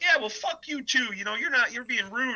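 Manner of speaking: high, mock-indignant retort; lightly voiced annoyed stranger; imitating offended strangers. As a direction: play the offended stranger snapping back.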